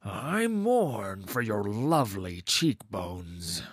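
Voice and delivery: slow, haunted line; eerie slow voice. Acted. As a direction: speak it slow and otherworldly. Masked actor speaking eerily.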